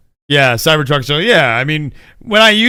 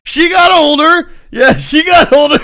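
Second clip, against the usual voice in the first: giddy excuse; 11 semitones higher, 9 dB louder than usual. Real, not acted. Excusing the joke, laughing.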